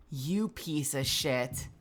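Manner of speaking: low, soft and slow, stress "You"; slow bitter mutter. Real, not acted. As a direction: drag out "You piece of shit" quietly. Repeats curse slower.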